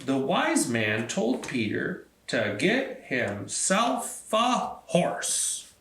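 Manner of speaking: plain reading voice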